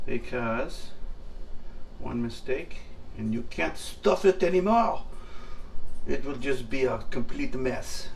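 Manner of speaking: light mock French accent. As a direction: say it with a hint of French. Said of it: "as the French would say".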